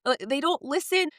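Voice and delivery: high-pitched re-enacted near-tears plaint; tearful retelling. Mother confessing she has no control, mock tearful. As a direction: voice lifts as if about to cry. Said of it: "my eyes started to water".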